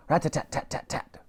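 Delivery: soft gunfire rattle. A narrator's reading. Machine gun fires.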